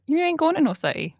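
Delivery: high sassy jab. Acted. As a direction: say it with attitude. Sassy answer option read out.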